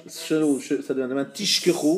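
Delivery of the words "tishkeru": "tishkeru" with SH in place of Z, drawn out; zayin misread as shin. Shown as a demonstration. Teacher showing a common misreading, zayin turned to SH. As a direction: say "tishkeru" with a long hushing SH. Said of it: "ils lisent mal le zayin".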